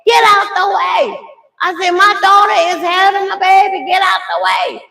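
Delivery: high loud shouting at cars; yelling at traffic. Retold or imitated. Mother yells at cars at red light, high loud shout. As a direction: bellow them out of your way. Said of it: "I'm like".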